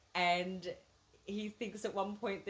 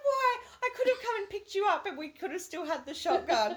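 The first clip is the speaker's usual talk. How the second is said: exasperated retold outburst; 10 semitones higher, 3 dB louder than usual. Exasperated friend voiced in retelling.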